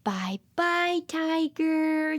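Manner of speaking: plain reading voice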